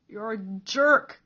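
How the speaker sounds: loud, slow tearful accusation; sobbing insult. A narrator's reading. Peter sobs out an insult at Cole. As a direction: spit it out between sobs. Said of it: "Peter sobbed".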